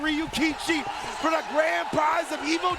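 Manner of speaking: big booming hype call, building; booming prize announcer. Acted. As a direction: announce the grand finals like a ring announcer. Hype grand finals announcement.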